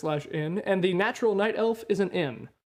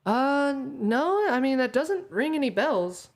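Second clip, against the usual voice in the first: lightly higher innkeeper voice; 6 semitones higher than usual. Acted. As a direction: answer as an unhelpful innkeeper.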